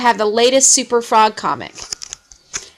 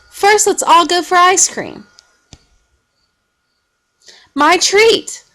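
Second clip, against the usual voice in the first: proud treat; 7 semitones higher, 3 dB louder than usual. A narrator's reading. Henry proudly offering ice cream.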